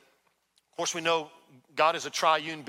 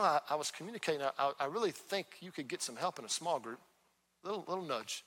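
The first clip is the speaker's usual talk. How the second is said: gentle nudge; 7 dB quieter than usual. Acted. Holy Spirit nudging someone toward a small group, gently. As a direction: drop the volume to suggest it gently. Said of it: "is like a gentle nudge".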